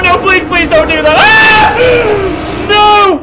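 high, loud panicked screaming; plead, then scream in real terror; terrified scream. Real, not acted. Player pleading with the horror game, screaming in fear.

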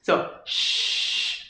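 sh hissed without voice; sh sound. Shown as a demonstration. Teacher isolating the sh sound. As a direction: hiss a plain sh sound. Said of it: "first like an S H".

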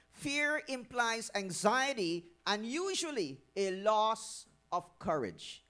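slow and deliberate, weight on loss; slow emphasis. Real, not acted. Preacher stressing what fear costs, slow and deliberate. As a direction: draw each word out.

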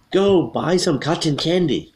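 high, slow, emphatic order; emphatic command. Acted. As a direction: command it with an exclamation point. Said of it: "here's a command".

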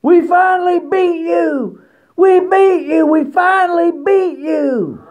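very high-pitched, drawn-out mock yell; imitated fan yelling. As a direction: mimic an annoying fan yelling in a high squeal. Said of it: "fan just started yelling".

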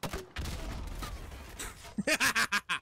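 long high laugh falling off; laughter. Real, not acted. Player laughing after a kill, high fading laugh. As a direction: laugh at the lucky shot.

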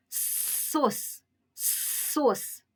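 сос with a long hissed first с; stretched с at the start of сос; drawn-out consonant. Shown as a demonstration. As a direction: hold the opening с before releasing сос. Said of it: "Чуємо звук с".

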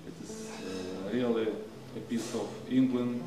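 usual speaking voice

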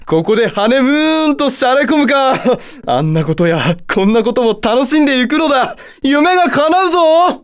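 high, giddy demon lord rapture; gleeful fantasizing. Acted. Satan dreaming of a honeymoon, giddily excited.

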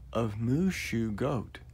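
ordinary delivery, nothing marked

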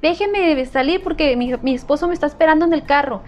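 high, pushy fake-mother voice; lying stranger. Kidnapper telling the helper to move aside, high and pushy. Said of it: "decía".

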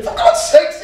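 very high Irish exclamation; hammed-up Irish astonishment; Irish accent. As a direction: cry out in amazed Irish brogue. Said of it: "a little bit of an Irish accent".

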